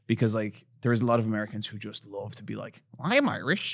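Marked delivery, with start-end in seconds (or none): 3.0-3.7 lightly mocking, higher-pitched boast; eager American mimicry. Irishman mimicking Americans who love claiming Irishness, lightly mocking. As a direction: put on a proud wannabe voice.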